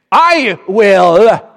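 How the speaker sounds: loud, high, drawn-out hen's declaration; defiant retort. Acted. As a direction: belt it out as the defiant hen. Hen's retort in acted story.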